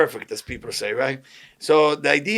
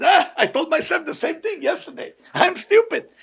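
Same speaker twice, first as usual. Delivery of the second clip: much higher, laughing through the words; laughing self-mockery; 11 semitones higher than usual. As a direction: laugh it off, cheerfully agreeing. Retelling his reply to an insult.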